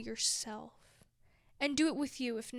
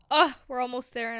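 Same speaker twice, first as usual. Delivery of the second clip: emotional rush; 11 dB louder, faster than usual. Real, not acted. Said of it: "I'm getting emotional".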